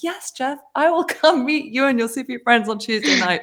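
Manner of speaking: high, bubbly, barely holding back laughter; giddy acceptance. Retold or imitated. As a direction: gush your yes, breaking into a laugh. Her delighted acceptance of the invite.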